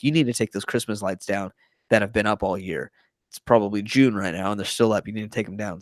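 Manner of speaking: ordinary talk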